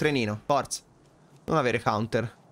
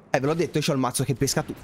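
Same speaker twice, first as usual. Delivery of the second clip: ordinary delivery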